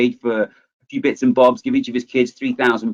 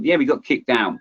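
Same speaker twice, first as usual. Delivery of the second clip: ordinary talk, nothing marked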